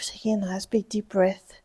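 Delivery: soft, whispered breathing cue. Shown as a demonstration. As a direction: whisper it to settle them.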